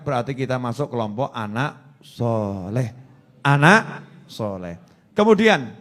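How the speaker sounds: ordinary delivery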